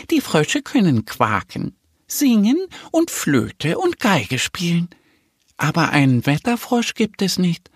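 high little duck character voice; knowing little duck. A narrator's reading. Little duck tells the bear what frogs can do, matter-of-fact. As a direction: list it in a high, measured duck voice.

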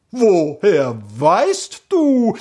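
slow, high, stunned question; aghast. A narrator's reading. Keeper staring at the prince who knows him, stunned. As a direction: draw out each word in astonishment. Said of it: "Entgeistert starrte der Wächter".

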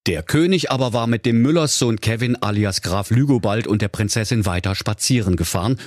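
ordinary delivery, nothing marked

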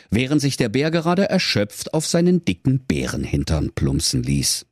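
plain reading voice

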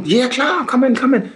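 high-pitched, enthusiastic invitation; bubbly retold greeting, pitched well up; eager welcome. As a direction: imitate an excited host waving someone in. Acted eager welcome at the door.

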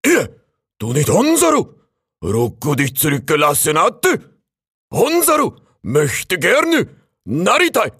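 put-on high, loud crane with a Japanese accent. A narrator's reading. Crane greeting the raccoon from the roof, high and loud.

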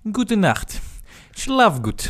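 put-on spooky Russian-accented goodnight; russian accent in german. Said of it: "wenn jemand was mit einem russischen Akzent sagt".

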